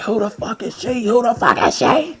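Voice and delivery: put-on high squealing girlfriend voice, demanding; mock angry girlfriend. Retelling his ex's jealous outburst in her voice.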